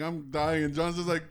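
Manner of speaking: normal talking voice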